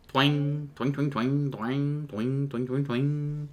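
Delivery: high, ringing sung twangs; imitated jaw harp. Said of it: "So you hear this".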